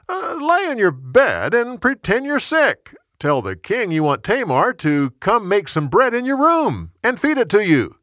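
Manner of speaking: high-pitched, animated character voice for the cousin; cousin giving advice. Acted. Cousin gives him a plan to get Tamar, high animated advice.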